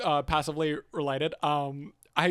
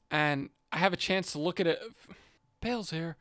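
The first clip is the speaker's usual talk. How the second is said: normal talking voice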